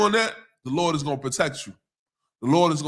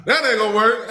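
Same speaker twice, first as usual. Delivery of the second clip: laughing; 5 semitones higher, 5 dB louder than usual. Real, not acted. Repeats the wife's line laughing.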